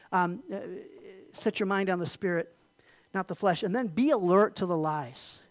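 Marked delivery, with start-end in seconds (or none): none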